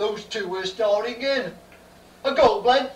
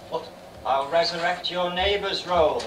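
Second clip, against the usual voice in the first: ordinary talk, nothing marked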